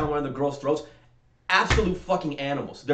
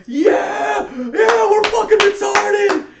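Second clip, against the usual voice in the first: high, loud, slowed mock cheering of a dumb crowd; 12 dB louder, much slower than usual. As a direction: yell it like a braying rodeo fan. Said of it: "and everyone goes".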